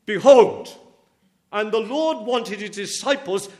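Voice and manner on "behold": Behold called out loud, falling at the end; Behold, declared to the congregation; proclaimed behold. Shown as a demonstration. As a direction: ring out Behold like a summons. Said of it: "is significant".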